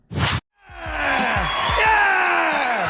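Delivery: high, drawn-out cheering yell; hype shout. Real, not acted. Comedian hyping the audience at show start, long high yell. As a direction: whip up the crowd.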